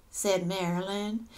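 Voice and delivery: usual speaking voice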